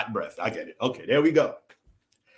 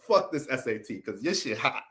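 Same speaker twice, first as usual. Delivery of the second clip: normal talking voice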